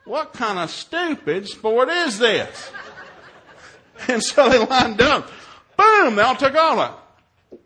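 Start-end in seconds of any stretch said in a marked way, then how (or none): 0.1-2.7 loud, higher-pitched comic disbelief; exasperated. Retold or imitated. Dad at his first cross-country meet, exasperated. Mocking a new sport. 5.8-7.0 loud, high burst; explosive exclamation. Retold or imitated. Comedian telling how the race began, explosive.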